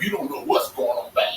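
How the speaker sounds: normal talking voice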